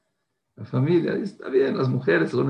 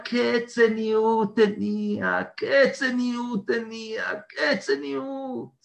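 slow, high cry that fades to a tearful whisper; sobbing lament; 8 semitones higher, much slower than usual. Retold or imitated. Father mourning his daughter, crying fading to a whisper. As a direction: break down as the question repeats. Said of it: "Empezó a gritar".

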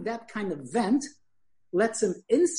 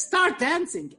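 much higher and louder, bursting out; punchline blurted out; 10 semitones higher, 3 dB louder, slower than usual. Retold or imitated. Father repeating his punchline to his son, pitched up and louder. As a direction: pop the punchline up high after the laughter. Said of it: "He says".

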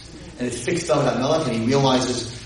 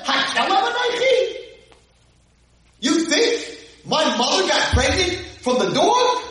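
mocking giant. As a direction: sneer down at the little boy. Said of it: "So this big giant's like".

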